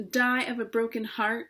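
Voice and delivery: ordinary delivery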